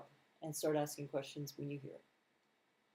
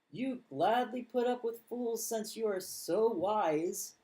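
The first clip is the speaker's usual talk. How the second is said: slow, dry mock praise, higher and louder; sarcastic; 4 semitones higher, 5 dB louder, slower than usual. Reading Paul's jab at the church, sarcastically. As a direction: lay the sarcasm on thick.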